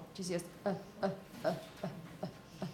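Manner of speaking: short, quiet, low unstressed "uh" grunts; schwa sound. Shown as a demonstration. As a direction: drop out tiny neutral "uh" sounds, soft and low. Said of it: "You will pronounce it with the schwa".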